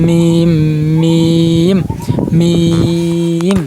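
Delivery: মিম drawn out on a level note; steady chanted মিম, growing longer; lips-together M. Shown as a demonstration. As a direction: close both lips and let the end of মিম hum. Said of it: "দুই ঠোঁট মিশিয়ে".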